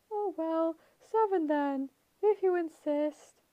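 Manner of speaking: high, coaxing falsetto. A narrator's reading. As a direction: coax sweetly in falsetto. Grover's falsetto.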